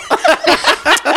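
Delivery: high burst of laughter. Real, not acted. Laugh after joke.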